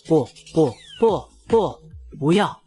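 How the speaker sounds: anguished, acted refusals; distraught repeated murmur. A narrator's reading. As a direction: let the disbelief burst out in separate cries. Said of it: "嘴里嘟囔着".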